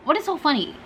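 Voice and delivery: high, puzzled repeat; baffled question. Retold or imitated. Teller imitating herself asking laughing grandma, high and baffled. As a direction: ask it high and confused.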